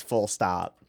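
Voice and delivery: ordinary talk, nothing marked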